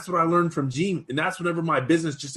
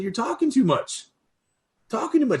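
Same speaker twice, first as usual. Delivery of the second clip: mock mentor scolding; 8 semitones higher than usual. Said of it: "He said".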